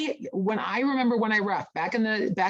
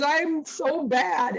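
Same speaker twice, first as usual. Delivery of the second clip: high, drawn-out mock wail; crying; 8 semitones higher, much slower than usual. Young line judge after her mistake, mock crying. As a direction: imitate a tearful, self-blaming whine, stretched out. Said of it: "she's already crying".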